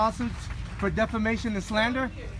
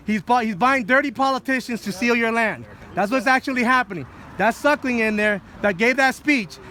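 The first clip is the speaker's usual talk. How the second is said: street preaching; 3 semitones higher, 5 dB louder than usual. Real, not acted. Rallying livestream viewers.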